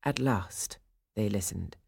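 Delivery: ordinary delivery, nothing marked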